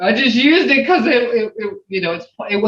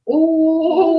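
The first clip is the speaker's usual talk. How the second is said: sympathetic ooh wee; 9 semitones higher than usual. Shown as a demonstration. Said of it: "it can go the other way".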